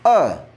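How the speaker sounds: अ said alone as "uh"; short A sound. Shown as a demonstration. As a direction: sound out अ, the vowel in run. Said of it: "इसका उच्चारण अ भी होता है".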